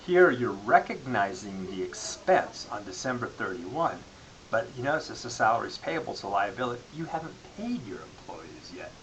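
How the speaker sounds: ordinary talk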